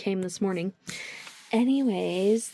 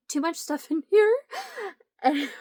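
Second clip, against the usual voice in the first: high, slow and squeaky; 8 semitones higher, much slower than usual. Real, not acted. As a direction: squeak it out in embarrassment. Embarrassed, talking about her room.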